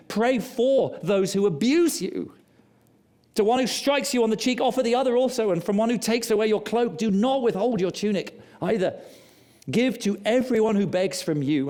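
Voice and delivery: high, loud scripture read, building fervour; full-force preaching. Acted. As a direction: proclaim it to the back row.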